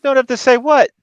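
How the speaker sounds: high, loud indignant question; baffled cop. Acted. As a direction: throw it back in disbelief. Cop baffled by director's note.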